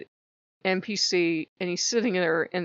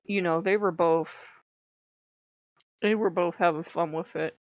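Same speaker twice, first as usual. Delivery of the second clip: ordinary delivery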